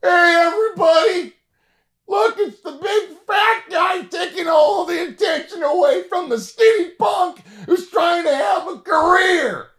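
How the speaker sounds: put-on young comic heckles his hero in front of the crowd, shouting; yelling to the audience. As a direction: bellow it across the studio like a brash young comic. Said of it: "And I go".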